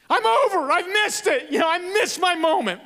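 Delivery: imitating a sobbing young woman; sobbing wail. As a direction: wail it like a heartbroken girl. Sobbing girl quoted.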